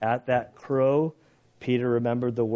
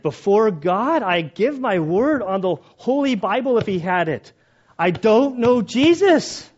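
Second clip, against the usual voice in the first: loud high insistent oath; swearing an oath; 9 semitones higher, 8 dB louder than usual. Retold or imitated. Peter swearing loudly to the accusers. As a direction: swear it desperately before God. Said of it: "he's swearing".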